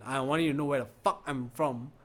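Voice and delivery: normal talking voice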